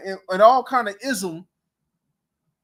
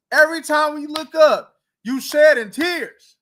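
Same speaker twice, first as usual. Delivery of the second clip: raised, teasing call-out; louder mocking jab; 5 semitones higher, 5 dB louder than usual. Real, not acted. Roasting the crying coach.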